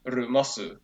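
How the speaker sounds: Japanese-accented "rumosu", r for L; Japanese-style take on lumos. Shown as a demonstration. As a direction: say "rumosu" like a Japanese speaker, ending on u. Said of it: "something like Japanese, it would be, like".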